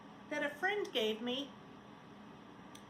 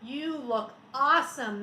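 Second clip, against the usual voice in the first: put-on children cheering; 8 dB louder than usual. A narrator's reading. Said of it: "the children said".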